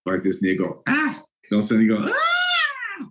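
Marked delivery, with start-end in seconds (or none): 0.9-1.2 sudden high-pitched yelp. Retold or imitated. Dexter warming up before a game, high yelp. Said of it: "he had this high voice". 2.0-3.0 very high piercing squeal, higher than the first; squeaky high shriek. Retold or imitated. Dexter suddenly yelling in the locker room, squeaky shriek. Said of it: "he had this high voice".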